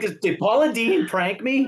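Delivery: high, stammered retold disbelief; mock disbelief. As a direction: stammer it, acting duped. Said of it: "I'm like".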